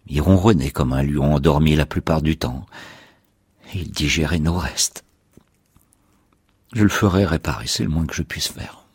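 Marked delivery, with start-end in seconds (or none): none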